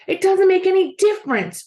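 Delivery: high, loud frustrated yell; angry yell. A narrator's reading. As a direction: yell it right at him. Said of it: "Joe yelled at Billy".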